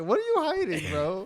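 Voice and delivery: high, loud prying question; playfully suspicious needling. Retold or imitated. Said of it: "I was always like".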